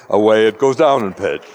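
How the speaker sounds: low pitch demo. Shown as a demonstration. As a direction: let the pitch fall away as if receding. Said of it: "that's the Doppler effect, slightly exaggerated".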